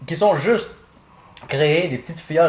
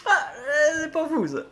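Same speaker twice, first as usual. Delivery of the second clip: laughing. Real, not acted. Reacting to a hot sauce.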